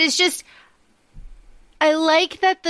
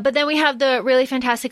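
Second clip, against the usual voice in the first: usual speaking voice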